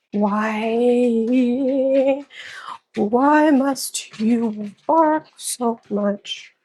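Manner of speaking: slow, drawn-out lament to a dog; real drawn-out exasperation at the barking; exasperated plea. Real, not acted. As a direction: stretch each word out in weary protest.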